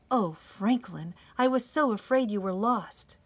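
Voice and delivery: expressive storybook reading; gentle picture-book narration; reading to children. A narrator's reading. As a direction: read it aloud to a circle of small children.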